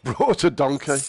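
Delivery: laughing through the punchline. Real, not acted. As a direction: break into a chuckle mid-word. Laughing while retelling donkey anecdote.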